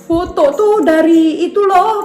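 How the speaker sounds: daughter's voice. Retold or imitated. A mother voicing her daughter home from school, high and eager. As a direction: mimic a child bursting in with news. Child reporting war photos at school.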